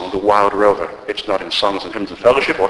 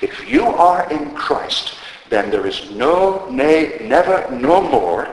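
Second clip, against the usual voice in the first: slow, deliberate stress on "no, nay, never, no more"; powerful, emphatic; 5 semitones higher, slower than usual. Retold or imitated. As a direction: give "no", "nay" and "never" each a slow, ringing weight. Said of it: "emphatic way is this".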